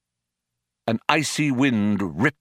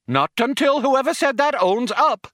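high, loud, insistent scolding; stern matron; 5 dB louder than usual. A narrator's reading. Computer refuses until the culprit confesses, stern matron. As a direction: hold it high like an offended schoolmistress. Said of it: "urged the computer".

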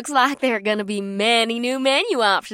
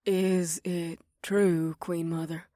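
shaky, hushed question; trembling; 4 semitones lower, 8 dB quieter, slower than usual. Acted. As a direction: ask it with a tremble. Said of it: "asked in a trembling voice".

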